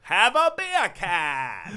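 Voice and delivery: very slow, loud mock Australian. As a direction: draw out every word like a parody Aussie. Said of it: "very slow and deliberate with their pronunciation".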